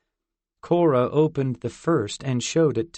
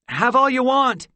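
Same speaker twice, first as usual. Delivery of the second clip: much higher, louder shout; 13 semitones higher, 8 dB louder than usual. A narrator's reading. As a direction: shout it as he pushes the box at her. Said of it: "Fort shouted".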